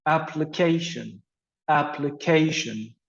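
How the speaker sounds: "application" stressed on its third syllable, app-li-CA-tion. Shown as a demonstration. Teacher modelling word stress, "application" stressed on CA. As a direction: land on the "ca" in "application". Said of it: "So we have the stress on the third".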